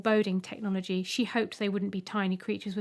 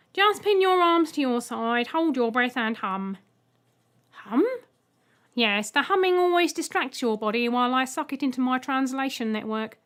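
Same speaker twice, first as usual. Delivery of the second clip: louder, stiff programmed welcome; robot egg Mip; 3 semitones higher, 5 dB louder than usual. Acted. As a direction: speak like a dutiful machine. Mip instructs Ethel.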